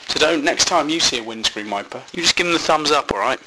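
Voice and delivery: normal talking voice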